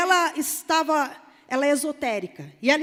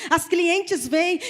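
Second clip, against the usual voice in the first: ordinary talk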